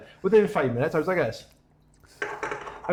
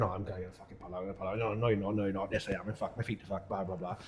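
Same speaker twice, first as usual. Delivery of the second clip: much lower and much softer, an inner voice talking itself out of the race; 9 semitones lower, 12 dB quieter, faster than usual. Retold or imitated. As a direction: mutter the doubts under your breath, low. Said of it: "battling my head going".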